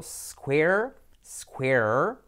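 "square" with a clean initial S, no "es-"; no E before S. Shown as a demonstration. Teacher warning Spanish speakers about the added E, clean S onset. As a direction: start "square" straight on a hissed S. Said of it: "no poner una E delante".

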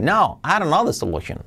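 lively falling melody on "No", then "aronno the solution"; linked with intonation. Shown as a demonstration. Teacher adds intonation to joined words, linked "I don't know the solution". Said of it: "unir las palabras y luego darles entonación".

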